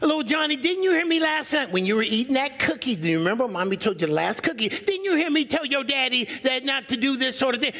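high, fast mock wife talking at the child; mock-sweet needling through the kid; nagging wife voice. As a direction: pitch up and nag past the child at the husband.

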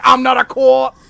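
high shouted gangster line; Departed improv shout. Acted. Friend improvising a Departed scene, shouting. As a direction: yell it like a movie tough guy. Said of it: "That was some Departed, improv".

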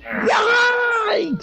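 sharp high yelp of alarm; startled yelp. Real, not acted. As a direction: yelp it out in shock. Sudden yelp during game.

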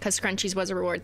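normal talking voice

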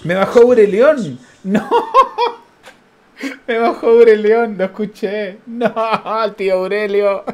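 high-pitched, half-laughing protest; laughing dismay. Real, not acted. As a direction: wail in amused disbelief, laughing. Opponent plays a feared card.

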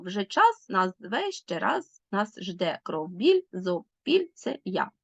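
every word a separate beat, as in "час... нас... зве"; one-syllable scansion. Shown as a demonstration. Teacher showing brachycolon to pupils, slow word-by-word beats. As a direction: read "кров, біль" slowly, one stressed monosyllable at a time. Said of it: "кожен рядок якого побудований з одного складу".

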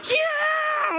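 very high, drawn-out whoop; high-pitched, elated yell; thrilled dad's cheer. Retold or imitated. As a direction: re-enact cheering along with your kid. Dad cheering back on ride.

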